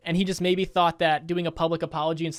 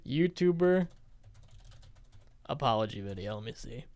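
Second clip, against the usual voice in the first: muttering to himself; 6 semitones lower, 6 dB quieter than usual. Real, not acted. Muttering while searching for videos.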